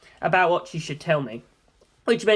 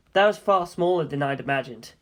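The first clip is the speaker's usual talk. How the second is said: plain reading voice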